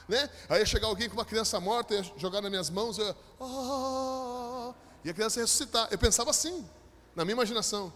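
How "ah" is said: high, sustained mock miracle cry; long dramatic "ah". Preacher imagines raising a dead child, hammed-up cry. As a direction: hold one high, even "ah".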